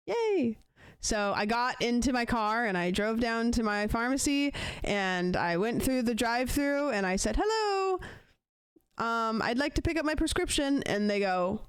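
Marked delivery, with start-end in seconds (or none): 0.1-0.6 mock cheerful yay. Text says prescription ready. 7.4-10.8 sweet customer voice. Retold or imitated. Polite greeting at pharmacy drive-thru.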